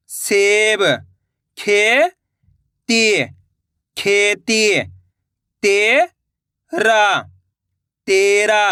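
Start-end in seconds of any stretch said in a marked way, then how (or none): 1.6-3.2 खेती drawn out slowly, syllable by syllable; slow syllable split. Shown as a demonstration. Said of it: "मैं किस तरीके से". 5.6-7.0 तेरा drawn out slowly, syllable by syllable; slow syllable split. Shown as a demonstration. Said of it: "मैं किस तरीके से".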